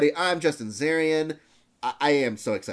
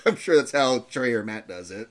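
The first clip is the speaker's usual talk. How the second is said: ordinary delivery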